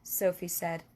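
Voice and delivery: ordinary delivery, nothing marked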